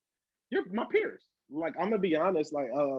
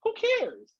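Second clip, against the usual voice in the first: much higher, brushing it off; dismissive scoff. Real, not acted. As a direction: toss it away with a scoff.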